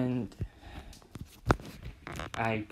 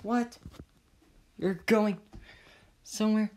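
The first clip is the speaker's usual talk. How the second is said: incredulous; 8 semitones higher than usual. Acted. Acted classmates doubting a boast.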